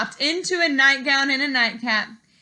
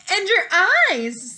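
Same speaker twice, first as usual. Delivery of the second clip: put-on high, slow, wondering girl voice; a curious little girl peering close; 9 semitones higher, 6 dB louder, slower than usual.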